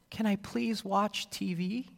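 put-on sweet little child's plea; sweet and cute. Shown as a demonstration. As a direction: ask nicely like a cute little kid. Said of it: "One sound sounds sweet and cute".

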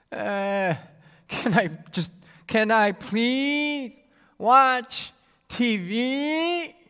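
put-on a long, drawn-out nagging child's whine; annoying whine. Shown as a demonstration. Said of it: "the other, well, straight up annoying".

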